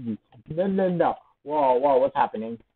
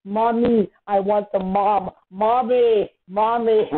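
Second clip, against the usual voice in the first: higher, whiny calls for mom; mock cry for mommy; 3 semitones higher, slower than usual. Player playfully wailing for their mom mid-game, mock cry for mommy. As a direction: call out like a helpless little kid.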